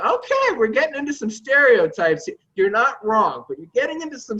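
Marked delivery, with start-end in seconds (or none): 0.0-2.4 high, loud startled protest. Retold or imitated. Himself objecting to the landlord, high and startled. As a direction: pitch jumps up in disbelief, then pushes back. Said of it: "I'm like".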